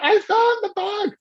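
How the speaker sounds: high, excited retold shout; thrilled, high-pitched announcement; eureka cry. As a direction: cry it out like a sudden discovery. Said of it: "I ran down the street".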